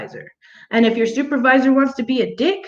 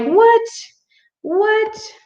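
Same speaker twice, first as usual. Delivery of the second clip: much higher, incredulous double take; shocked disbelief; 13 semitones higher than usual. Retold or imitated. Telling the cop's crew question, stunned high disbelief. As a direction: squeak out a stunned what at the cop. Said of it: "And I'm like".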